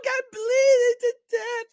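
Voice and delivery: high, loud, slow mock wail. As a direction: wail it like you're dying, hammed up. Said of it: "I'm like".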